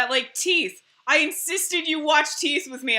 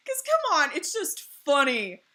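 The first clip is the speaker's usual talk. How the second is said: ordinary delivery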